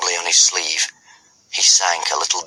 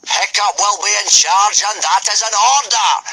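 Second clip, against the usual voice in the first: roar; 13 semitones higher than usual. Acted. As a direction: roar for silence. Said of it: "screamed Gobber".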